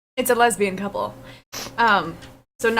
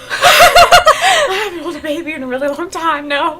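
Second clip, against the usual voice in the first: high, loud, laughing mock panic; panicked refusal; 6 semitones higher, 7 dB louder than usual. Offered a baby to hold, flustered refusal. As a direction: squeal the excuse in a fluster.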